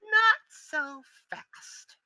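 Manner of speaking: high, slow, drawn-out orc mage voice; sneering orc mage, high and slow. Acted. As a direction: stretch it out like a gloating villain. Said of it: "He goes".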